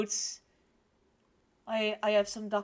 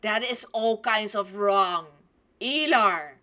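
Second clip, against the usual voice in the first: raised, disapproving voice; loud scolding; 9 dB louder than usual. Real, not acted. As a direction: tell off the story character loudly. Scolding the story character.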